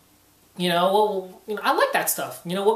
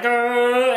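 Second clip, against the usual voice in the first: louder drawn-out fake growl; mock straining scream; 5 semitones higher, 6 dB louder than usual. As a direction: strain loudly as if powering up. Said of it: "screaming, you're like".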